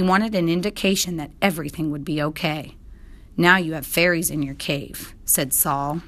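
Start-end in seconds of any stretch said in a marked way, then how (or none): none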